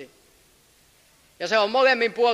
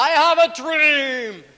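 slow, loud, higher proclaiming; shouted quote; 7 semitones higher, 7 dB louder, much slower than usual. Retold or imitated. Preacher quoting Martin Luther King, shouting slow and loud. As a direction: boom it out like an orator at a rally. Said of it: "hän huusi".

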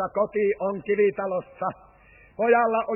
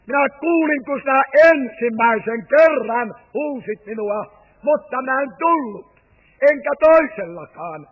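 put-on boy's outburst; 5 semitones higher, 9 dB louder than usual. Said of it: "tämä pikkumies seisoo".

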